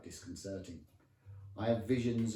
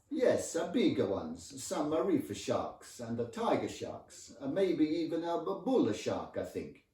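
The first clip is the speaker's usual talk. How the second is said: loud, lilting Italian husband; sing-song Italian accent; 7 semitones higher, 6 dB louder than usual. Acted. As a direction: enthuse loudly with an Italian lilt. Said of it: "she loved that sing-song accent".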